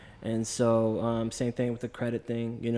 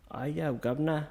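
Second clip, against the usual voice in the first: higher, playful mock Cockney; Cockney accent; 4 semitones higher than usual. Shown as a demonstration. Showing friends what Cockney sounds like, put-on Cockney accent. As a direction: toss it out as a Cockney. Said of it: "a Cockney accent where it's more like".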